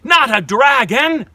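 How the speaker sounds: exasperated fire chief. Acted. Fire chief scolding the dragon, high and loud. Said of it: "the fire chief cried".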